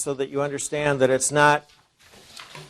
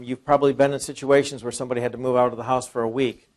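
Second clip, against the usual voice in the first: usual speaking voice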